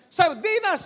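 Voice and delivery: high, loud cry of dismay; he can't believe it's sardines again; shocked outburst. Acted. Worker shocked at his lunch.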